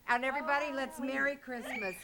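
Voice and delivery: ordinary delivery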